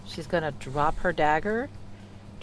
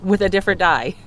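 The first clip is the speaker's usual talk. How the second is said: louder, higher excited repeat; 5 semitones higher, 11 dB louder than usual. Real, not acted. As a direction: shout it out, thrilled. Repeats news of crit.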